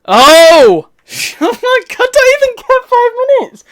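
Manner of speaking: high loud frustrated cry; exasperated shriek. Real, not acted. Gamer shrieking at another interruption, high exasperated cry. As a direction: shriek in disbelief.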